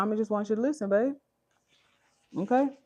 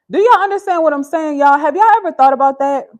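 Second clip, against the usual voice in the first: loud, high, pressing questions at the viewers; rising indignant appeal; emphatic rant to chat; 8 semitones higher, 13 dB louder than usual. Real, not acted. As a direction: demand an answer from the chat. Mother ranting to live chat.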